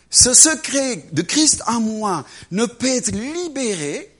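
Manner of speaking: ordinary talk, nothing marked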